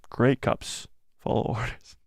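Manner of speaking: ordinary talk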